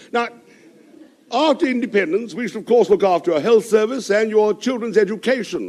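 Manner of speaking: orate like a pompous British envoy; speechifying diplomat to the crowd. Acted.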